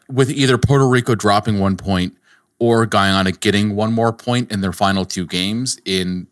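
normal talking voice

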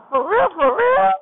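high, loud double-checking disbelief; amazed. Retold or imitated. Imagining her thrilled reaction to the car, high and loud. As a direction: shout it like you can't believe your luck.

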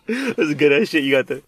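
ordinary delivery

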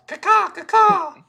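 high, loud, slow bird caws; shrill screeching bird. Retold or imitated. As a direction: screech like a crow. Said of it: "I'm a bird".

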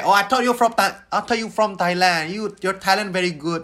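put-on Thai-accented English. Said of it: "terus dia kayak".

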